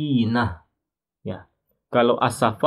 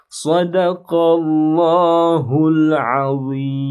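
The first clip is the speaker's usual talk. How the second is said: slow chanted recitation, higher and louder; Quran recitation; 6 semitones higher, 7 dB louder, much slower than usual. Acted. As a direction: intone the verse with full tajwid. Closing formula of recitation.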